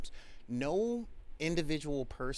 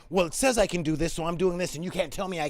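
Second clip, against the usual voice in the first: pushy rules lawyer; 5 semitones higher, 9 dB louder, faster than usual. Retold or imitated. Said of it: "But instead of going".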